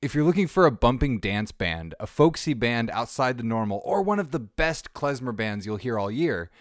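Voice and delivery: lively promo read with a pitch lift on folks. Acted. Host reading a promo for a band, as an upbeat ad. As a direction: sell the band like a radio spot.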